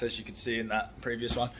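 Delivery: normal talking voice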